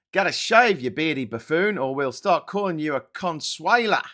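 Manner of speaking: loud, high Aussie twang reading the comment; Australian accent. Pretend. Reading a viewer's cheeky comment in a loud Australian accent. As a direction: read it out in a broad Australian accent. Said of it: "I said that in an Australian accent".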